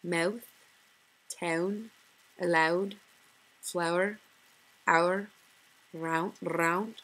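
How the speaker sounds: mouth, town and round with Scottish vowels; Scottish accent word list. Shown as a demonstration. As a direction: read each word the Scottish way. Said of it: "For example".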